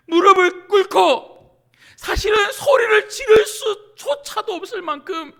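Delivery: loud, high, straining cry; impassioned, near-wailing shout; anguished preaching. Real, not acted. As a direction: preach it as if overcome by desperation. Impassioned preaching about desperate prayer.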